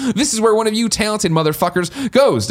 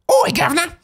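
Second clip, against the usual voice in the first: high mock Cockney cry; a hammed-up British Oi, governor. Host reading an English fan's letter, mock Cockney shout.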